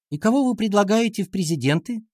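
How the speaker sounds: put-on high girlish question; schoolgirl class monitor. A narrator's reading. Class monitor asking teacher for a candidate, high girlish voice.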